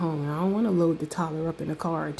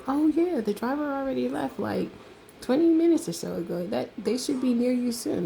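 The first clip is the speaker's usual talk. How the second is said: higher, breezy voice for the woman on the phone; restaurant woman quoted; 7 semitones higher than usual. Retold or imitated. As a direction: lift the voice up high, casual and unbothered. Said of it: "she's like".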